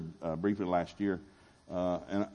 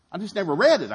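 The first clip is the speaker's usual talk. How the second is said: higher, louder I just never read it, punching read; emphatic repeat; 8 semitones higher, 9 dB louder than usual. Shown as a demonstration. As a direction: shout it out for emphasis.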